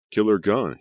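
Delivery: loud, high excited exclamation; exclaiming. A narrator's reading. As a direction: burst out with it, impressed. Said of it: "he exclaimed".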